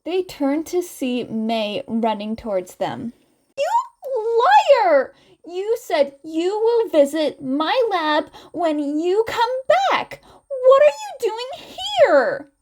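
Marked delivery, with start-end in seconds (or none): 3.6-12.5 put-on high, loud accusing girl's voice; much higher and louder, playfully outraged; Mei accusing. Mei scolds the twins for not visiting, high indignant. Mei's voice held.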